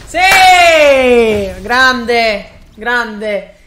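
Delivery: loud, elated victory shouts; breathless italian goal commentary. Real, not acted. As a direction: yell it out like a goal call. Celebrating a win in game.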